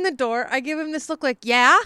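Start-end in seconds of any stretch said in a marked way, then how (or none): 1.4-1.8 loud, curt challenging yeah; annoyed yeah. Retold or imitated. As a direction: make it a blunt "what do you want". Glaring at the neighbour at door.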